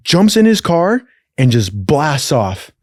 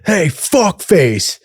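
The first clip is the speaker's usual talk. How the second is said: higher, drawn-out cop callout; cop's hail; 6 semitones higher, much slower than usual. Retold or imitated. Cop to drunk man outside the bar, mock commanding call. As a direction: imitate a cop hailing someone, pitched up and slow. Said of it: "And he's like".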